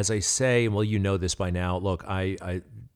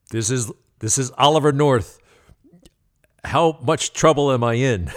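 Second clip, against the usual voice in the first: louder, a touch higher, playful guilt; sheepish confession; 6 semitones higher, 10 dB louder than usual. Retold or imitated. North answering the angry caller, playfully sheepish and louder. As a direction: own up with a grin in the voice. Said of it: "And he says".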